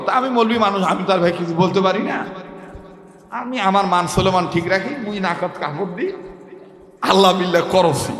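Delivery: usual speaking voice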